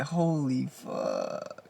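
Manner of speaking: hushed, drawn-out disbelief; stunned whisper. Retold or imitated. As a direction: let it slip out under your breath in shock. Stunned reaction to bad news.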